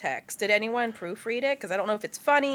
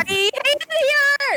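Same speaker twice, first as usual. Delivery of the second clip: exasperated shout; 6 dB louder, much slower than usual. Real, not acted. Mock despair at gross post.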